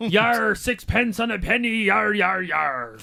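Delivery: slow, drawn-out cartoon pirate. Keeping up the pirate radio joke, hammed-up pirate voice. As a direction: stretch every "yar" like a stage pirate. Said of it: "That's what they say on pirate radio".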